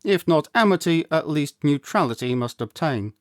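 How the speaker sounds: usual speaking voice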